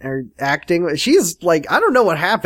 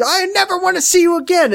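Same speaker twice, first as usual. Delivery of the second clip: mock melodramatic girl. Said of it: "like, lose?".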